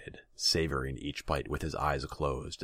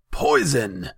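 high, loud cry of alarm; sudden outraged yell; alarmed shout; 11 semitones higher, 12 dB louder than usual. A narrator's reading. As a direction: shout it out to the whole room. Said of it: "Tasigur shouted".

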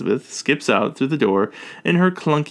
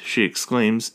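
ordinary delivery

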